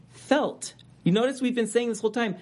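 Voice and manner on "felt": felt lifted high and leaned on; preacher hammers the word felt to the church, high and stressed. Shown as a demonstration. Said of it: "the emphasis here is on".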